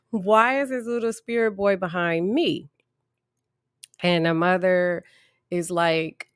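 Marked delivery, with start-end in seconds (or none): 0.0-2.7 high squeal of a laugh breaking into the question; nervous laughing. Retold or imitated. Therapist hearing the spirit follows her, laughing nervously. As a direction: laugh through the disbelief. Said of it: "And I'm like".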